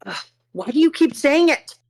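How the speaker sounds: high exasperated groan; pained, pleading to stop; annoyed ugh. Real, not acted. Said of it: "When does Anne die?".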